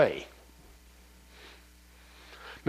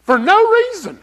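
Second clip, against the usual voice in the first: indignant exclamation; 13 semitones higher, 9 dB louder than usual. Real, not acted. Kid kicked him in the shin.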